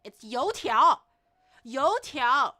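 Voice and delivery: annoyed at menus saying dough fritters, loud insistence; insistent correction. Real, not acted. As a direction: set them straight on the name "Youtiao". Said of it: "It's not dough fritters".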